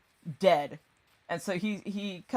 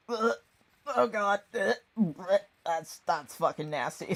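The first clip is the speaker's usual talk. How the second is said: disgusted; 3 semitones higher than usual. Retold or imitated. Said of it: "completely disgusted by it".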